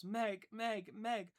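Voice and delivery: loud, high cry of love through sobs. Acted. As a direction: cry it out, half sobbing. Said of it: "she cried again".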